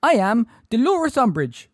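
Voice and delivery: loud, very high frightened voice; high-pitched, terrified. A narrator's reading. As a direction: announce yourself in a high, panicky pitch. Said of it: "in a high-pitched, terrified voice".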